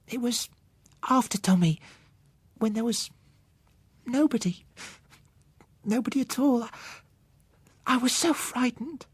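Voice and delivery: put-on a scared child confessing her fear; frightened little girl. Child Susan voiced in story.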